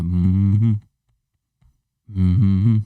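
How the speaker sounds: rhythmic chest hum like the Wolf of Wall Street scene; McConaughey hum. Retold or imitated. Imitating his racing heart as a McConaughey hum. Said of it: "Full Matthew McConaughey".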